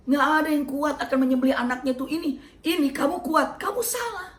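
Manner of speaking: high, loud, pressing tempter; insistent devil. Acted. Satan hammering Ibrahim with loud, high warnings. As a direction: push the doubt hard and loud. Satan argues loudly against sacrifice.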